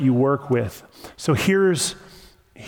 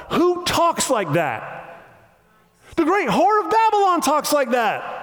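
much higher, incredulous exclamation; indignant preaching; 10 semitones higher than usual. Real, not acted. Preacher exposes the tempter to the congregation, indignant exclamation.

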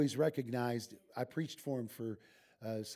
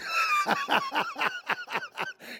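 laughter; 7 dB louder than usual. Real, not acted. Laugh after punchline.

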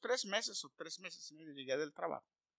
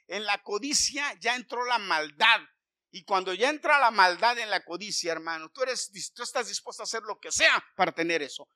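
preaching at full force; 7 semitones higher, 13 dB louder than usual. Acted. Pastor warning his brothers against coveting, fiery loud preaching.

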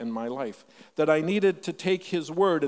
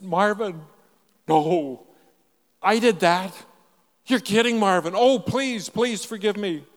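louder, higher pleas from the father; pleading father; 5 semitones higher, 7 dB louder than usual. Retold or imitated. Father on the phone begs his son to forgive him, loud and pleading. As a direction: beg forgiveness in a rising rush. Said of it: "he said".

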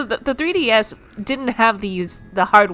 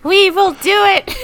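put-on much higher, slow, louder small-child voice; determined kindergartner; 12 semitones higher, 6 dB louder, slower than usual. One friend voicing kindergartners hauling a heavy Switch, determined child voice. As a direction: play a tiny kid insisting bravely. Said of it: "but they're like".

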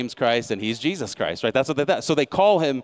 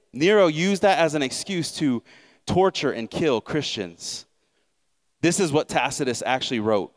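ordinary talk, nothing marked

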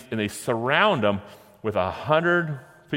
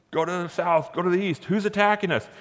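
much higher, frantic calls of confused soldiers; panicked Midianites; 8 semitones higher than usual. Retold or imitated. Preacher voicing panicked Midianite soldiers in a higher voice. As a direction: sound like startled enemies shouting orders.

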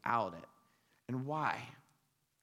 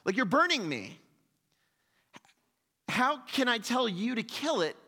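much higher, loud frantic shout; panicked ghost man; 10 semitones higher, 6 dB louder than usual. Retold or imitated. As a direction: yell at the angel to get back. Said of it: "and the man says".

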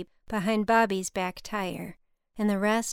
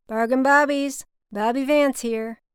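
loud, higher thick cotton drawl; Bobby, thick Southern drawl; 5 semitones higher, 8 dB louder than usual. A narrator's reading. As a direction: answer the phone with a big Southern drawl. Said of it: "Bobby answered in his thick cotton drawl".

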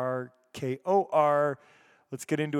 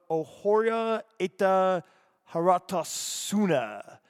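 Japanese accent; 5 semitones higher, 3 dB louder, slower than usual. Real, not acted. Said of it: "like, a Japanese accent".

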